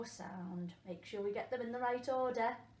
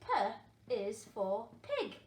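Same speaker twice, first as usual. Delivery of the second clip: very slow, word by word read-back; deliberate classroom read-back; 3 dB louder, much slower than usual. Shown as a demonstration. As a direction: spell it out for beginners. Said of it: "teacher reading the sentence slowly".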